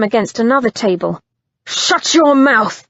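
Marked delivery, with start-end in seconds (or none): 1.7-2.9 slow, loud, furious command; furious order. Acted. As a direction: bite off each word. Simon after backhanding him.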